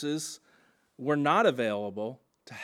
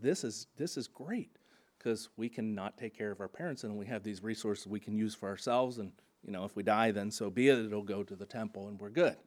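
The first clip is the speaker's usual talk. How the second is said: softer, lower imitation of self-serving reasoning; lightly voiced, low and hushed rationalizing; offhand self-justifying aside; 6 semitones lower, 5 dB quieter, faster than usual. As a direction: toss it off quietly, as if privately justifying it. Adult children's imagined self-satisfied reasoning, voiced.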